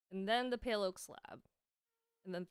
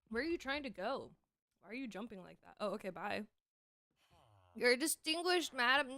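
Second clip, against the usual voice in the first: talking while chewing; words through a mouthful of sandwich; mouth full. Real, not acted. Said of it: "I like talking with my mouth full".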